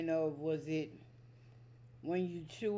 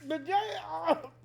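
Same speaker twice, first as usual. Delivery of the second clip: high-pitched through sour taste; high excited squeal; 10 semitones higher than usual. Real, not acted. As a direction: squeal while chewing sour candy. Reacting to sour candy.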